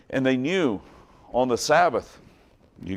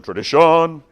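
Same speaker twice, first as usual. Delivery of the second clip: loud, high theatrical cry of "tradition"; big showy exclamation, pitched up; Fiddler on the Roof quote; 6 semitones higher, 9 dB louder than usual. Acted. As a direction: proclaim it like a stage musical number. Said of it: "if you've seen Fiddler on the Roof".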